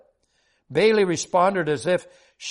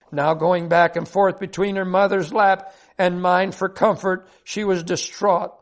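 usual speaking voice

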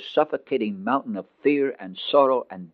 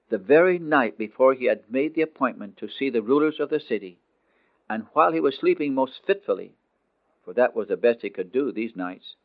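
ordinary delivery, nothing marked

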